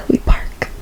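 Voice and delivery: ominous little whisper. Real, not acted. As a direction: drop to a whisper of dread. Dreading the long walk.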